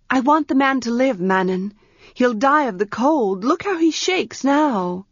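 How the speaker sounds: put-on a young priestess pressing for an answer; Arha's voice. Young priestess Arha urgently asks her servant for a room.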